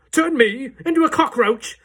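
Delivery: loud, high mocking outrage; scornful shout. A narrator's reading. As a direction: scoff it loudly. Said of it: "shouted Mister Jenkins".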